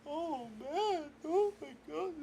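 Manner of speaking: high, soft wail of hurt; upset whine. Acted. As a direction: whimper it high, close to tears. Upset at being laughed at.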